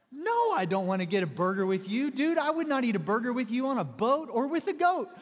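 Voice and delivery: high, loud sing-song Dr. Seuss rhyme; Doctor Seuss rant. Retold or imitated. Pastor mocking his roommate in a loud, high Seuss rhyme. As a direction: rant in bouncy rhyme, pitch climbing high. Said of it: "I would just go all Doctor Seuss on him".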